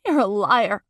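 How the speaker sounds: loud, punched accusation; angry accusation. Acted. Girlfriend calling her boyfriend a liar, angry. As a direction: throw it at him loudly.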